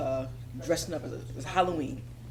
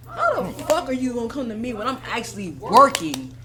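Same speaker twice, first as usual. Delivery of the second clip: retold anger; 3 semitones higher, 8 dB louder than usual. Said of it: "I was so pissed".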